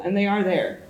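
ordinary delivery

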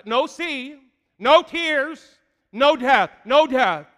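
preaching climax. Real, not acted. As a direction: build to a shout on no tears, no death.

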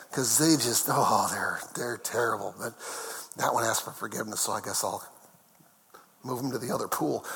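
very low, soft, reluctant muttering; grudging mock God. Preacher parodies God reluctantly sparing a sinner, low grudging mutter. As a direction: mutter the concession under your breath.

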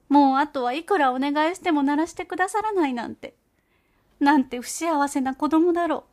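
high, loud pleading mother mouse voice; tearful mother mouse. Acted. Mother mouse pleads to Gauche about her child, high and tearful.

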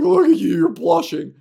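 high, loud mock taunt of a teaser. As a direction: squeal it like a schoolyard tease. High mocking teaser imitation.

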